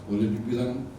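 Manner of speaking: ordinary talk, nothing marked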